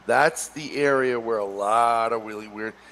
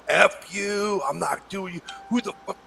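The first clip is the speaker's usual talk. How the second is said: imitating furious biker; 8 semitones higher than usual. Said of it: "he went ballistic".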